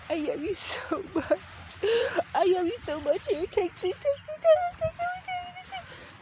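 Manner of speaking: very high, cracking gushy voice; Queen of Hearts squealing with fake tears; gushing Queen of Hearts. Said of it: "because she's like".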